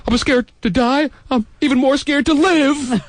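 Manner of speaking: high-pitched mock confession of fear; hammed-up fearful whine; mock frightened plea. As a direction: plead in a high, put-on scared voice.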